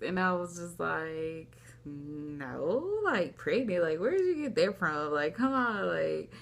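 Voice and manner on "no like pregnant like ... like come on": incredulous replay with big swooping pitch; retold disbelief. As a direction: scoff at the very idea, voice sliding up on the questions. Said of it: "I was not feeling it".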